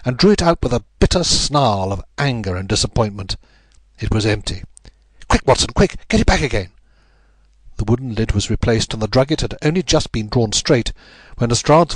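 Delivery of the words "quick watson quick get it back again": high, urgent call; urgent command. A narrator's reading. Holmes rushes Watson to replace the lid, urgently. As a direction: hurry them along in a raised pitch.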